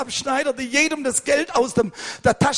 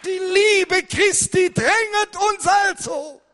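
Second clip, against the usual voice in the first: preaching at full force; 7 semitones higher than usual. Real, not acted. Preacher to the brothers about the Bible school, preaching at full force.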